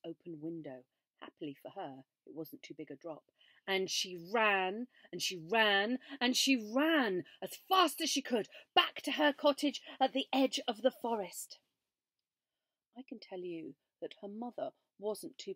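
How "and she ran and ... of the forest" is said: louder, building run; rising, urgent storytelling; excited chase. A narrator's reading. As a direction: build it up as she flees.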